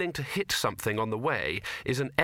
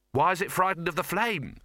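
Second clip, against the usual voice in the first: higher-pitched, lightly voiced character; puzzled questioner; 6 semitones higher than usual. A narrator's reading. Sol watching the thing retreat, higher puzzled question. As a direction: ask in puzzlement.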